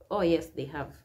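ordinary delivery

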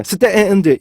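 slow, broken-up stand; breath not connected. Shown as a demonstration. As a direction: stand dragged out slowly in pieces. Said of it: "호흡을 계속 끊어버리게".

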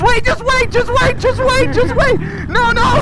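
panicking gamer. Real, not acted. Fireworks being shot at him.

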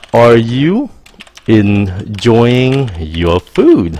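very slow "enjoying your food"; slow waiter question. Shown as a demonstration.

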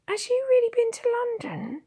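very high, loud, wondering question; awed. A narrator's reading. Blacksmith's wife asking about the London trip, awed. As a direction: ask in high-pitched amazement. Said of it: "she asked in awe".

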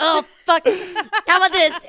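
loud, high, laughing desperation; hammed-up frantic second offer; flustered suitor bird. Rejected guy bird tries harder, loud and flustered.

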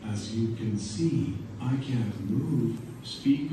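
ordinary delivery

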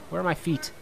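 ordinary talk

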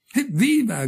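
high-pitched hurrah; cried cheer. Acted. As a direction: cheer like a delighted crowd, without full volume. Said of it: "gridò la corte".

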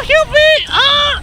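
panicked shout over the fight; frantic yelling. Real, not acted. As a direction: scream at your healer to save you. Still begging for heals.

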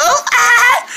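very high test note; high-pitched "ah". Acted. Trying to find his normal voice, high-pitched squeal. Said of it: "you want my voice to go back to normal".

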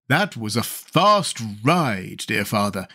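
slow, stretched character voice; lingering put-on exclamation; exclaiming pumpkinhead. A narrator's reading. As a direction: marvel in a drawn-out pumpkinhead voice. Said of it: "he exclaimed".

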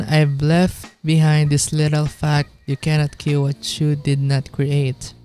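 ordinary talk, nothing marked

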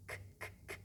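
k, k, k as soft voiceless puffs; whispered k. Shown as a demonstration. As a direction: breathe out three bare k sounds. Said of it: "Kelimeyi böyle ayırın".

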